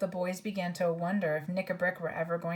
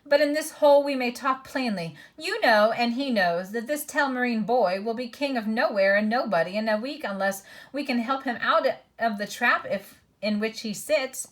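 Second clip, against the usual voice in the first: sneering dwarf voice; 4 semitones higher, 7 dB louder than usual. A narrator's reading. Dwarf voice continues.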